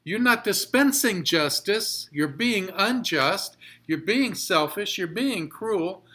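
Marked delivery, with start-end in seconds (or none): none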